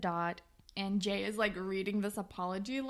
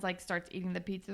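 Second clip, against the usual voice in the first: ordinary talk, nothing marked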